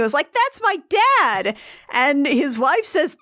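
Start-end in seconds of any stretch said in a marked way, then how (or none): 0.3-1.6 put-on high, drawn-out proud child; proud kid. Shoveler's youngest watching on TV, proud. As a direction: lightly voice a proud little kid. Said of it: "The youngest one".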